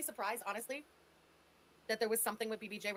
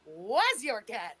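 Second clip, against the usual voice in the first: slow, louder, drawn-out correction; condescending; 2 semitones lower, 7 dB louder, much slower than usual. Retold or imitated. Mocking Chantal's claim, slow condescending jab. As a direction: stretch it out smugly like a know-it-all. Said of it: "poke her in the nose and go".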